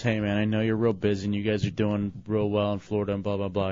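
low, quiet, quick murmur; quietly mumbling through a downbeat call; despondent friend. Retold or imitated. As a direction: imitate a downcast friend, hushed and low. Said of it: "then he does this".

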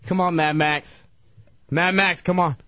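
ordinary talk, nothing marked

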